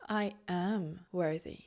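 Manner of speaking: low, slow and soft; calm, from the belly. Shown as a demonstration. Coach showing a calm way to claim worth, low and soft. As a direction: settle it deep in the belly. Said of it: "So maybe more calm, more from the belly".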